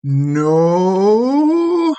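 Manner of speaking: long, rising, high "no"; drawn-out critter call climbing in pitch; tiny creature's reply. Acted. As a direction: stretch it out, sliding up high. Game master voices the creature's reply.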